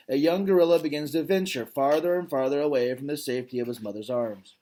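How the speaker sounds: ordinary delivery, nothing marked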